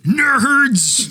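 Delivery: high, loud brutish shout; Ogre yell. Retold or imitated. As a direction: bellow it like a big dumb jock. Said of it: "like Ogre from Revenge of the Nerds".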